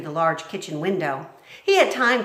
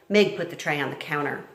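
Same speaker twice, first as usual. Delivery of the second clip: plain reading voice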